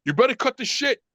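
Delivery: mock angry scolding without shouting; father flipping out. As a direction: imitate a dad laying down the law. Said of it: "now my father flips out".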